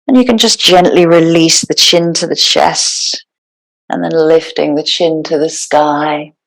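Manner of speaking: calm, unhurried meditation guide with long pauses; guided meditation. Real, not acted. Yoga teacher settling the class into breathwork, guided meditation. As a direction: ease them into stillness, phrase by phrase.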